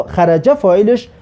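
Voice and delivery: ordinary delivery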